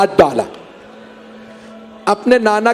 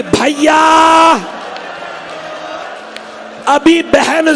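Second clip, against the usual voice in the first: weeping plea; 7 semitones higher, slower than usual. A narrator's reading. Sister begging her brother not to raise the cry of loneliness, a weeping plea. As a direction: beg the brother through tears. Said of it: "और रो रो के कहने लगी".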